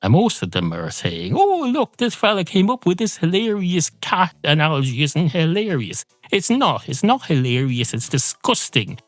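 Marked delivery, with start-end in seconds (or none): 1.3-6.0 high, gushing mock delight; mocking book authors. Comedian mocking authors, in high gushing mock delight. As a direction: squeal it like a giddy fan.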